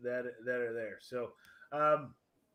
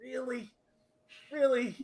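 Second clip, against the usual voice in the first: mock disbelief; 8 semitones higher, 5 dB louder than usual. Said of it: "you're just like".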